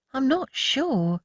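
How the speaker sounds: put-on high, slow hesitant girl voice; doubtful. A narrator's reading. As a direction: hold back, unsure. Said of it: "Rose frowned".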